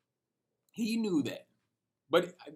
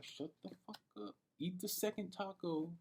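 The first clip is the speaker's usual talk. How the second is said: much softer, fast, talking to himself; inner voice; 4 semitones higher, 11 dB quieter than usual. Retold or imitated. Telling himself to calm down and eat, hushed inner voice. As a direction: hush yourself like the voice in your head. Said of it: "in my brain".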